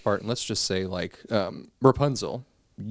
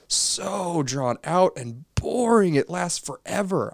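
drawn-out, higher-pitched complaint; exaggerated exasperation; 8 semitones higher, slower than usual. Real, not acted. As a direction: stretch the words to show how tedious it is. Complaining about an endless movie scene.

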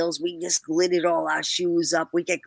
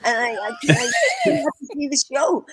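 laughing plea; 8 semitones higher than usual. Retold or imitated. Pleading to Frank, laughing.

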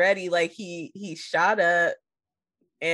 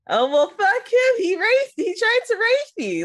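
high, upset mock girl voice, pitched way up; 4 dB louder than usual. Friend retells the movie's girl telling Jimmy about the guy, high mock girl voice. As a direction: squeal it out like a frantic young woman.

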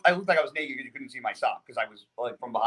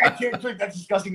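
laughing disgust; 7 semitones higher than usual. Retold or imitated. Recalling the salty drink from his coach, laughing in disgust. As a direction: laugh through the complaint, pitched up.

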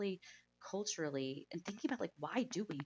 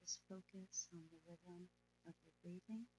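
soft spa whisper; 8 dB quieter than usual. Acted. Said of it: "let's go ahead and meditate".

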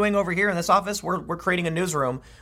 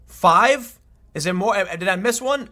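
higher, louder disbelieving "Five?" with a rise; incredulous; 4 semitones higher, 4 dB louder, slower than usual. Real, not acted. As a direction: say "Five?" like you can't believe it. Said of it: "Are you kidding me?".

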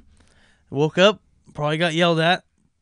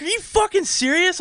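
higher mock shocked mother; shocked mom. Mother scolding her son, higher disbelieving voice. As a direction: screech the disbelief. Mom's outrage continues.

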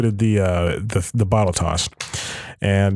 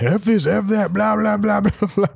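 mock rant, pitched much higher, in a put-on voice; mock tirade; 10 semitones higher than usual. Host imitating Crawford's long meeting tirade, a hammed-up mock rant. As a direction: imitate a boss ranting on and on. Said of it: "profanity-laced tirade".

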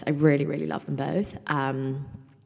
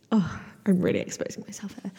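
breathy embarrassed whisper; hushed self-conscious aside; 5 semitones higher than usual. Real, not acted. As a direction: let it slip out as a whispered confession.

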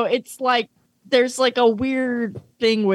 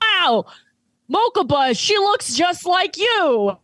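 mock amazement; 7 semitones higher than usual. Show characters pointing out the lookalike to Mokuba, mock-amazed. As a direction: marvel in a higher voice, stretching it out. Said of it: "They go".

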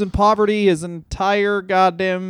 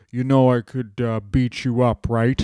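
low put-on wrestler voice; rough attempt at an old champion; Bruno Sammartino; 5 semitones lower than usual. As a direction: drop low for a casual boast. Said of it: "Bruno Sammartino just went like".

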